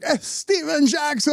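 high, sustained cartoon-character call; cartoonish father impression. Father greeting his impersonator, cartoonishly high. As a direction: announce the name in a high caricature voice. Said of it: "And my father's like".

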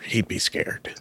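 soft whispered aside; whispering. Real, not acted. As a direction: whisper it playfully. Teasing compliment, dropped voice.

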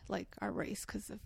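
hushed, breathy aside about race; whispered aside. Real, not acted. Telling her cohost the story, whispering the awkward bit. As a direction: drop to a whisper on the touchy part.

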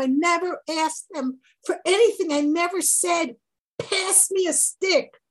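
pleading sermon at full force; impassioned preaching. Acted. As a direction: preach it with rising fervour. Impassioned teaching of Shimshon's plea.

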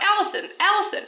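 high-pitched calling out at normal volume; mock yell. Woman hailing a passing catering worker, mock yell. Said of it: "so I yell out".

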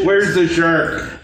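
giggling through the words; breaking up with laughter mid-line; laughing. Acted.

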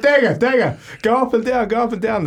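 high, loud, urgent calls to her partner; urgent calling. Retold or imitated. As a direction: call out fast, urging, voice up high.

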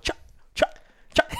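spaced, voiceless "chuck" pops; cluck practice imitation. Shown as a demonstration. Student walking the school hall practising clucks, voiceless chucks. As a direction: imitate clumsy turkey clucks by mouth. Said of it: "how to try to cluck".

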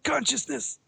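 high, melodramatic dying-hero voice; hammy stage actor. Acted. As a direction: ham up being stunned, going faint. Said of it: "overacting theater".

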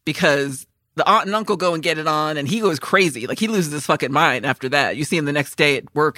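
ordinary talk

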